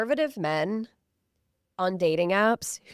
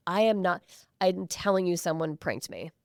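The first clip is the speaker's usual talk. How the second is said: ordinary delivery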